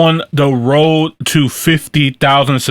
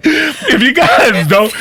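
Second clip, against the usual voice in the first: laughing; 11 semitones higher, slower than usual. Real, not acted. Host cracks up mid-sentence.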